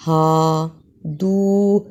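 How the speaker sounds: slow ہا with an airy light h. Shown as a demonstration. Teacher models the light h for students. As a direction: breathe out the ہا lightly. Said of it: "ہائی کی طرح نکالنا ہے".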